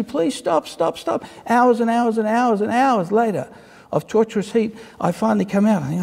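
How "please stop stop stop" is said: begging for mercy. Acted. Cup in the kiln pleading high for mercy. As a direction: whimper out a pleading stop. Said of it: "I'm begging for mercy".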